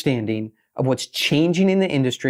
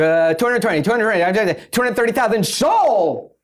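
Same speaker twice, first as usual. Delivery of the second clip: higher, louder rapid auctioneer patter; 7 semitones higher, 3 dB louder than usual. Shown as a demonstration. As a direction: rattle off bids like an auctioneer. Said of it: "but then you sound like an auctioneer".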